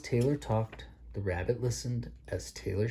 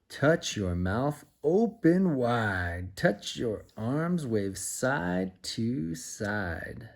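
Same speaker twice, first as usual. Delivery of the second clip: put-on slow, sing-song rhyme to a child; slow playful nursery chant; action rhyme; 4 dB louder, much slower than usual. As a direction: lead the little one through the motions.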